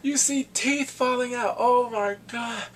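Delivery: high, drawn-out amazement; amazed. Real, not acted. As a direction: stretch it out, loud and high, astonished. Marvelling at the fight scene.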